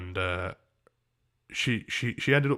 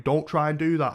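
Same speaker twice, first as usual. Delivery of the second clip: raised-voice warning; annoyed; 6 semitones higher, 6 dB louder than usual. Retold or imitated. Telling the woman at his door off, annoyed. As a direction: retell an irritated telling-off, higher and louder. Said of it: "I was annoyed about it".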